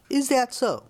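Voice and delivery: a touch of thick-headed challenge; dim longshoreman's retort. A narrator's reading. Longshoreman answering a woman's taunt, lightly challenging.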